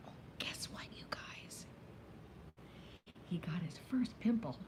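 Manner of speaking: hushed secret whisper. Real, not acted. Telling viewers a secret about Oliver, whispered. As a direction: whisper it like gossip.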